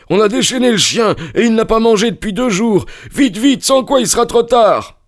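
put-on very high, panicky old servant; panicked splutter. A narrator's reading. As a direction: urge them on in a squealed panic. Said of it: "bredouilla-t-il". (affolement d'une voix suraiguë)

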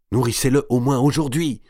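high, pressing appeal; urgent persuasion. A narrator's reading. Madame de Bouville implore pour le bébé. (persuasion pressante, voix aiguë)

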